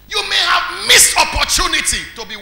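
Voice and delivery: loud, high appeal; preaching shout. Acted. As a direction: cry it out to the church.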